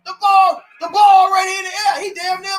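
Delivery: real anguished shouting; anguished yelling. Real, not acted. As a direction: cry out in protest at the call. Ranting at the referees.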